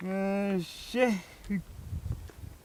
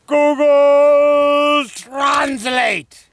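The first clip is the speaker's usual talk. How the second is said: booming demon lord; 8 semitones higher, 11 dB louder, much slower than usual. Acted. Wizard casts his potent spell.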